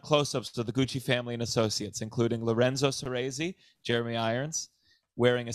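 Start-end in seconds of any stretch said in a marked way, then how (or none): none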